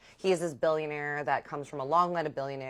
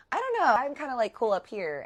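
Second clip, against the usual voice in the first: higher-pitched, nervous reluctance; awkward high-voiced no thanks; hesitant brush-off; 8 semitones higher, 3 dB louder than usual. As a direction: try to wriggle out of it politely. Declining to go below deck.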